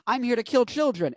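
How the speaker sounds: lightly voiced clown, pitched high. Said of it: "I'm the clown from It".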